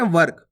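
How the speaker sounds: वर्क as one syllable, "vark"; half "r" in वर्क. Shown as a demonstration. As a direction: say वर्क with no vowel after the r. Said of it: "तो कैसे पढ़ेंगे".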